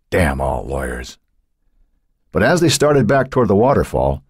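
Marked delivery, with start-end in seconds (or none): 0.1-1.2 slow, low-key bitterness; bitter. A narrator's reading. Pullman cursing lawyers after a door is closed on him, bitterly. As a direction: mutter it slowly, with bitterness. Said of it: "Pullman said bitterly".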